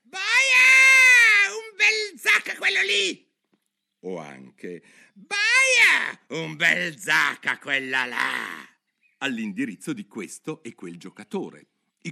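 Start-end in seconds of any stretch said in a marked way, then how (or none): 0.0-3.2 piercing, very high, drawn-out scream; shrieking. A narrator's reading. Queen yelling at players during the game, shrieking. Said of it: "baccagliare con tutti e di strillare". 5.1-8.7 high, drawn-out yell, dropping on the sentence; shrieking. A narrator's reading. Queen yelling at a lady player, shrieking. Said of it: "baccagliare con tutti e di strillare".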